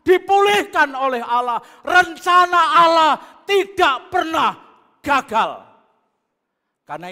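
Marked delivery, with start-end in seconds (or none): 0.0-5.5 loud, high preaching climax; preaching at full force. Acted. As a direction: proclaim it to the whole congregation. (khotbah berapi-api dengan suara lantang)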